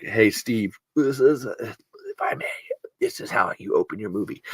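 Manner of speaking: soft secretive whisper to a producer; mock whispered pitch. Pitching a movie opening to Steve in a hushed whisper. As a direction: lean in and confide the pitch.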